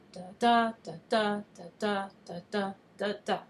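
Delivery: da-DA five times over, evenly pulsed, fading toward the end; iambic rhythm. Shown as a demonstration. Teacher reminds poets of the line's pattern, iambic da-DA rhythm. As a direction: beat out "da" in pairs, each second one stronger.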